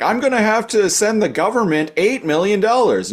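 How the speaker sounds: high-pitched indignant rant; mock exasperated boss. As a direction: play an outraged boss footing the bill. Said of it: "bitching them out".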